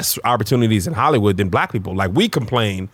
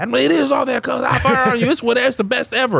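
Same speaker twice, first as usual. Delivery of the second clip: much higher, playfully insisting it is the best; mock hype defense; 12 semitones higher than usual. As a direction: overhype it in a jokey high voice.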